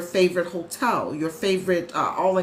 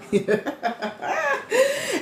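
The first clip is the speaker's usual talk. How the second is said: high-pitched laughing burst; real laugh. Real, not acted. Streamer joking with coach about lipstick, laughing.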